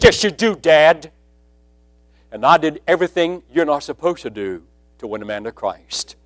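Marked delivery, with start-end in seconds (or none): 0.0-1.1 pushing back hard at his father; insistent. Retold or imitated. Son insisting his father needs saving, raised.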